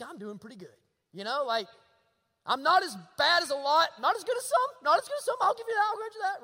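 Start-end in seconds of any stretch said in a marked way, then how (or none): 0.0-0.8 quietly, pleased with yourself; smug inner thought. Retold or imitated. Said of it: "And we think". 2.4-6.4 much higher, a smug voice conceding a little; mock self-righteous churchgoer. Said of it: "And we think".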